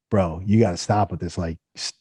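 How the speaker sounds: level with him, low and serious; sober intervention. Retold or imitated. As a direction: imitate a concerned friend reining someone in. CTO friend confronting him, retold.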